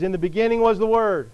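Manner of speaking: loud, ringing proclamation of the verse; forceful scripture reading. Real, not acted. Preacher reads John one to congregation, loud and emphatic. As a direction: build to a loud, measured declaration.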